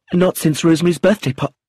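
put-on a young woman blurts a painful memory and stops; stopping in distress. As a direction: rush it, then cut yourself off. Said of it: "She stopped in distress".

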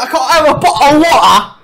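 high, loud hammed-up British accent; mock British accent. Teased over sounding British, hammed-up mock British accent. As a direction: exaggerate a British "bottle of water". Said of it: "He says, "What a bottle".

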